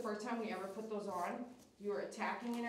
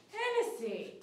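high pleading call; 11 semitones higher, slower than usual. Real, not acted. Dog snaps, calling its name.

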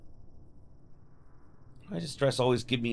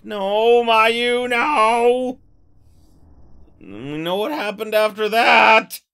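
louder and higher, warning a doomed character; yelling at screen; 8 semitones higher, 10 dB louder, much slower than usual. Real, not acted. Player yelling at Mayu not to enter the room, loud warning. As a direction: shout at her not to go in.

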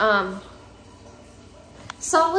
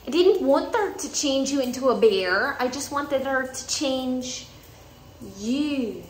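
bad Merida impression; 3 semitones higher than usual. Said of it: "the worst Merida impression ever".

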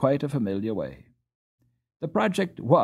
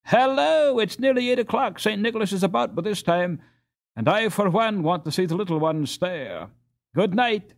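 loud, high call across the ice; 9 semitones higher, 3 dB louder than usual. A narrator's reading. Peter calling to his friends on the canal, loud and high. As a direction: call out to the whole group. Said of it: "called out".

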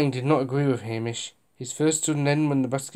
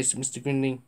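ordinary delivery, nothing marked

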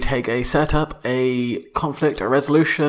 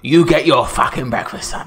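gruff dad; 4 dB louder than usual. Acted. Dad shouting at son.

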